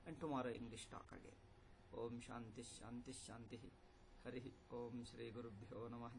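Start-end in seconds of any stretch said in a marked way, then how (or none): 1.9-6.1 soft, low closing chant of peace; hushed Sanskrit benediction; closing prayer. Acted. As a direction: intone the blessing quietly and reverently.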